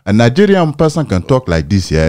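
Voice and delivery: high, melodic Nigerian accent. Shown as a demonstration. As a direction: lilt it loosely in a Nigerian accent. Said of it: "if I'm just speaking like a Niger".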